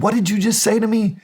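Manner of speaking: indignant, high-pitched demand; affronted challenge. Retold or imitated. Acted quarrel with the boss.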